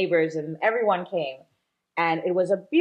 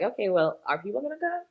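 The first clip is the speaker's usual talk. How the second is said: mild freaking out; 3 semitones higher, faster than usual. Retold or imitated. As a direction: retell a touch of worried self-doubt. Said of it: "I was freaking out".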